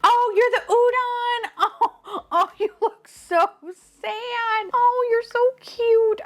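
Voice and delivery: high, teary laughing gush; laugh-crying coo. Real, not acted. Cooing at the sad-faced udon figure, laughing through tears.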